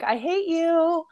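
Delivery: high, slow grumbling whine; grumpy voice personified. Pretend. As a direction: play a sulky voice complaining. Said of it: "my voice has just been like".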